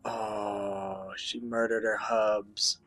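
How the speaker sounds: normal talking voice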